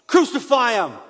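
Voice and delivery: loud, high cry of a mob; crowd's shout. A narrator's reading. Crowd answers Pilate about the prisoner, shouting. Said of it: "they shouted".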